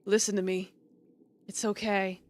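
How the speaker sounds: soft dying huntsman. Acted. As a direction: comfort her with fading strength. Dying huntsman comforts her.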